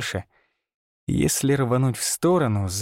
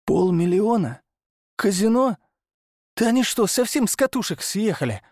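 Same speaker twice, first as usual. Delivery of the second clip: higher, incredulous outburst; shocked; 9 semitones higher than usual. Acted. Hunter stunned by their quarrel, incredulous shock. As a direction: disbelief rising with each word.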